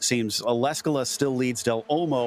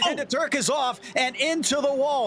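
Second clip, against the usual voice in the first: excited crash call; 9 semitones higher than usual. Real, not acted. Calling a sudden crash live.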